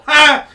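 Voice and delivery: sudden high laughing bark; loud burst of laughter. Real, not acted. Laughing at the slip.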